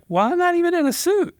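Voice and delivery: high squeaky "not even in a suit"; panicked squeak. Retold or imitated. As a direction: squeal it out in alarm.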